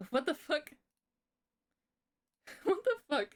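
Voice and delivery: high, baffled laughing reaction; real bewildered squeal of disbelief; startled disbelief. Real, not acted. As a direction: thrown off, pitch leaping into laughter. Startled by sound playing in background.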